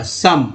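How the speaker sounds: सम said with a short a vowel; सम, o spoken as a; model word. Shown as a demonstration. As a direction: say सम like sum. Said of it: "ओ का उच्चारण अ हुआ".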